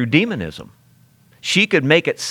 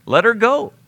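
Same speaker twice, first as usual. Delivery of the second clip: high, fed-up let her go; exasperated plea; 9 semitones higher than usual. Retold or imitated. Preacher urging Paul, exasperated and higher. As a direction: tell him off, higher.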